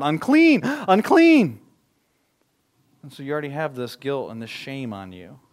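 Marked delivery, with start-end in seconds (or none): 0.0-1.6 loud high shouted warning; crying out. Retold or imitated. Leper warning passersby away, loud high shout. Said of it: "You're supposed to cry out".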